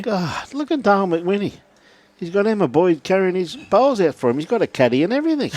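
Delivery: high, excited ribbing of a mate; mock outrage. Real, not acted. Mock outrage at player's caddy.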